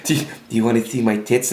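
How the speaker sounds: lightly imitated airhead come-on; a hint of a dim club girl's voice; Tanya. Airhead propositioning him in the car, lightly imitated. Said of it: "she talked like this".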